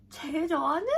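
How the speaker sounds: mock gasp of surprise; surprised question.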